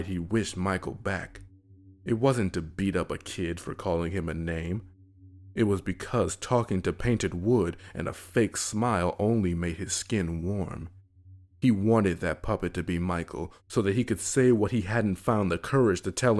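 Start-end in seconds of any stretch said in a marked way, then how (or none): none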